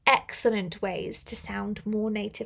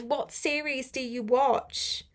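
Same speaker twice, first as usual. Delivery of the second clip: usual speaking voice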